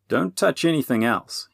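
insistent warning. A narrator's reading. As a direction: warn him off. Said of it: "he warned Robin".

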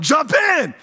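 high, loud mock yell from the riverbank; hammed-up frantic yell. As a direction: shout it like friends egging him on. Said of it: "they're yelling".

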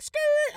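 high, loud skidding-tire noise; mock tire screech. Driver showing how fast they dipped, imitated tire screech. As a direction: squeal it like peeling off.

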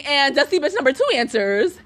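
usual speaking voice

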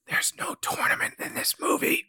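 whispered spoiler aside. Real, not acted. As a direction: whisper it like a secret. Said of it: "Reminder about the spoiler".

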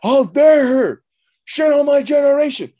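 retold outraged rant building to a high shout; cussing. Man cussing in the car about her insult, mock outrage. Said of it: "I started cussing".

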